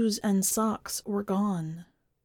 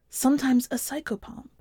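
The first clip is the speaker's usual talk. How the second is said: usual speaking voice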